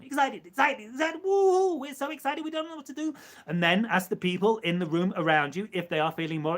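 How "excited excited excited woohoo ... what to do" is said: high-pitched, giddy whooping; pretend excitement. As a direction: burst into a hammed-up woohoo, then stay squeaky-high. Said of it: "the idea of excitement".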